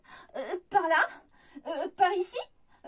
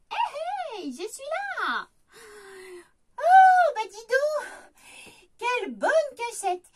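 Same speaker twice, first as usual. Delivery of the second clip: a high, loud burst of delight; surprised laughing praise for the hiding place; 6 semitones higher, 7 dB louder than usual. Acted. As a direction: gasp out mock amazement. Delighted find of the hider.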